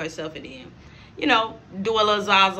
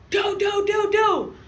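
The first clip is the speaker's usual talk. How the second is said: imitated knocking; 11 semitones higher, slower than usual. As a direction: shout out the knocking like a fist on a door. Someone knocking at the door.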